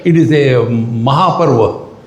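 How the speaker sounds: normal talking voice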